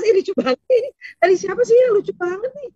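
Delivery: high, delighted chatter with rising ends; wife squealing over a funny clip, high and excited; amused wife. Pretend. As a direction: gush over a funny video like a tickled wife. Wife acting amused at funny video.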